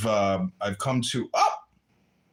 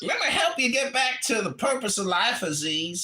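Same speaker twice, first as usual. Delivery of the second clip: mock-outraged put-on character yelling at the room; put-on comic voice; 2 semitones higher than usual. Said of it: "I guess I can stop talking like this now".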